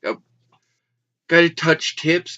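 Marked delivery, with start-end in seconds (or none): 1.3-2.4 loud. Acted. Calling out to viewers.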